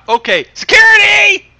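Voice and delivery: high, loud joking yell for security; hammed-up shout; mock shout. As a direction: call for security in mock alarm. Host jokingly calls security.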